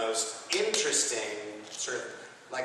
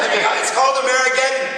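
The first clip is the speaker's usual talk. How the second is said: loud, high, hurried mock outburst; hammed-up shout; 12 semitones higher, 11 dB louder, faster than usual. Actor plugging Amerigeddon to the crowd, hammed-up shout. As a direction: shout the title in mock excitement.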